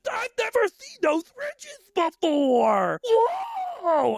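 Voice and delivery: high, shouted amazement; amazed cashier. Retold or imitated. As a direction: holler in wonder at the ridges. Cashier voiced in story.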